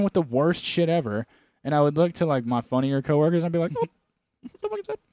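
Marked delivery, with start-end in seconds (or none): 3.7-5.0 very high, squeaky mock-sympathy voice; mocking silly voice. Mimicking to coworkers behind a gloomy woman, high mocking voice. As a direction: put on a tiny high voice to mock. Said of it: "I'd do that, that voice".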